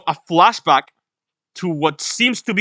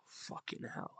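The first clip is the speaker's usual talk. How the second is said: sighed out, very soft; stunned whisper; 27 dB quieter than usual. Real, not acted. Stunned after the chapter ends.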